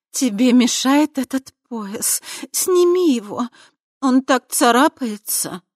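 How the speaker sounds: high, slow seductive coo; femme fatale. A narrator's reading. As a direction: purr it, coaxing him. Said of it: "проворковала она".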